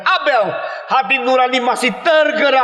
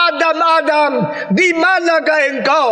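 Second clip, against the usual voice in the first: higher, ringing call of God; God calling Adam; 8 semitones higher than usual. Acted. God calling out to hiding Adam, raised and ringing. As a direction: call out across the garden, lifted high.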